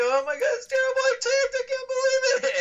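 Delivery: high, whiny hammed-up wail of despair; mock despair. Telling his coach he tanked, hammed-up high wail. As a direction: overplay the panic like a kid whining.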